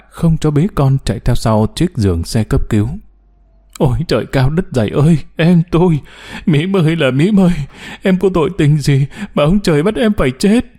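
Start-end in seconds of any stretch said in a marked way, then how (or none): 3.8-10.6 wailing lament, pitch rising higher through the lines; khóc than. Acted. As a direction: cry out the grief to the heavens, fast at first. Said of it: "đừng khóc nữa cháu". (khóc than thảm thiết, giọng cao dần)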